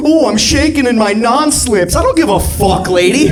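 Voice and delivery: high, scornful comeback; worked-up sarcastic retort; sarcastic mock fear. Mock fear, arguing with customer.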